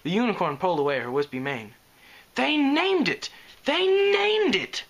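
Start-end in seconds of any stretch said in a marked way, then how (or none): 2.3-3.3 much higher gasp of horror. Acted. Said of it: "she gasped". 3.7-4.8 even higher, louder, slow horrified cry; shrieking gasp. Acted. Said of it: "she gasped".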